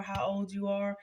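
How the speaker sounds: normal talking voice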